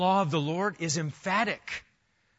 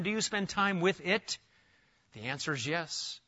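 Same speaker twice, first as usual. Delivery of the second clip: normal talking voice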